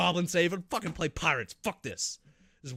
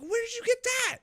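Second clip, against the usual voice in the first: very high squeaky disbelief, louder; outraged squeak; 5 dB louder than usual. Retold or imitated. Player shocked the enemy built a helicopter, high squeaky outrage. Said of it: "I'm like".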